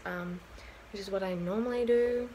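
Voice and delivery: ordinary delivery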